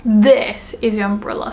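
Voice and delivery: THIS punched, rest falling away; stress on THIS. Shown as a demonstration. Pointing out Tanaka's umbrella, stress on THIS. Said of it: "I wanna put emphasis on the word this".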